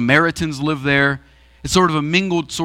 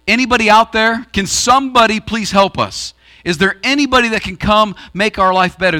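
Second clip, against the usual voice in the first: louder, higher plea to anyone; crying out for help; 6 semitones higher, 4 dB louder than usual. Acted. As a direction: call out to whoever might listen. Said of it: "just crying out to anybody".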